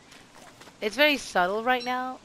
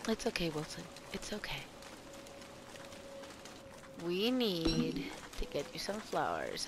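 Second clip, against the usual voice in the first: soothing; 2 semitones lower, 9 dB quieter than usual. Pretend. Consoling his character.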